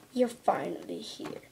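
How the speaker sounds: ordinary talk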